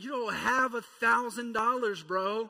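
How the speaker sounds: loud, teasing scold; mock exasperation. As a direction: playfully call the kid out. Retort to son, louder.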